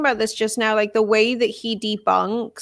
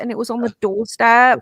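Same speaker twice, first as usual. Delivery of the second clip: ordinary talk, nothing marked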